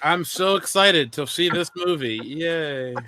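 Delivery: deadpan fake excitement; sarcastic. Real, not acted. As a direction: say it with zero enthusiasm. Sarcastic about sequel.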